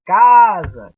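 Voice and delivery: "Casa" with a loud, drawn-out ca; loud, lengthened stressed vowel in "Casa"; stressed first syllable. Shown as a demonstration. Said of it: "é a sílaba mais forte".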